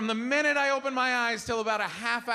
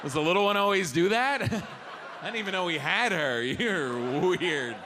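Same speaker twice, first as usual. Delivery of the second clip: awkward laugh; 2 semitones lower, slower than usual. Retold or imitated. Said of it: "You're like".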